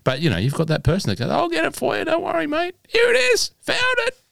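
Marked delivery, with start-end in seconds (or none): 1.3-4.2 shrill caricature of a keen mate finding the ball; silly helper voice. Retold or imitated. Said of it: "known for doing a voice".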